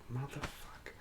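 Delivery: very soft swearing under the breath; muttered curse. Retold or imitated. Marine retelling his dread, muttered curse. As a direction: let the curse out privately, barely audible.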